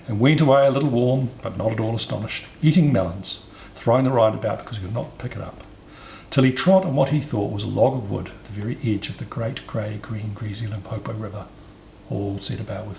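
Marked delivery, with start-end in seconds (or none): none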